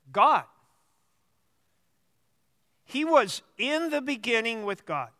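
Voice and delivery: deliberate reading with long pauses between phrases; measured, unhurried Bible reading; slow scripture reading. Real, not acted. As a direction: read slowly, letting each phrase sink in. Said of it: "I'm gonna read it rather slowly".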